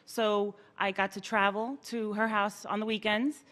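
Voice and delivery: normal talking voice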